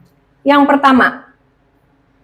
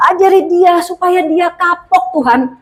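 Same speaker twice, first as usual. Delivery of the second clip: mock spiteful prayer; 8 semitones higher than usual. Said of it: "Wah, salah ya".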